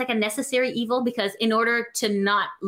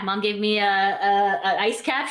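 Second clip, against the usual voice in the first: ordinary talk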